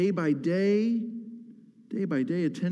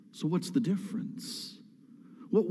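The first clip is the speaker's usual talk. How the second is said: ordinary talk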